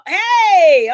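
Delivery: long, loud, stretched hey; drawn-out greeting. Real, not acted. Host hailing viewers coming in, drawn-out loud greeting.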